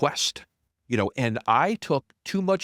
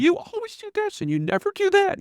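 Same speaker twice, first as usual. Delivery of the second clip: very high mock-complaining voice; mimicked nagging wife; 4 dB louder than usual. Wife accusing husband in counseling, mimicked high-pitched nagging. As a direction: imitate an accusing wife in an exaggerated squeal. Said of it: "every sentence from her starts with".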